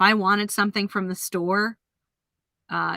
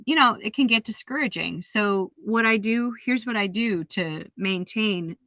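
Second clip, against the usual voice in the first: ordinary talk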